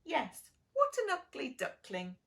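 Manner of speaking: mocking duckling. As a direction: echo the jeer like a cheeky chick. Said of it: "one of the ducklings said".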